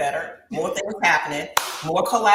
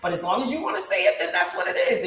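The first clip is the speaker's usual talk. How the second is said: ordinary delivery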